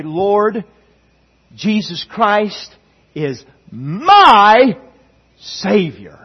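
"my" drawn out high and loud; slow declaration, leaning hard on a swelling "my" before "Savior"; stress on MY. Acted. Models a personal confession of faith.

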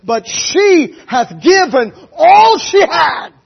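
high, slow ringing shout; preaching shout. Real, not acted. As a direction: preach it out at full force. Preaching climax.

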